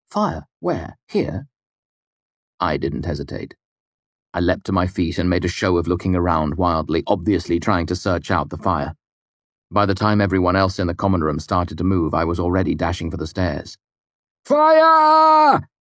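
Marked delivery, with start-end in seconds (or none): 0.1-1.5 higher, confused one-word questions; startled, confused questions. A narrator's reading. Startled patrons wondering about the fire, confused. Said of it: "when people are startled and confused". 14.4-15.7 high, loud, drawn-out alarm; shouted cry. A narrator's reading. Basil overacting his small part outside, shouted. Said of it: "the cries continued from outside".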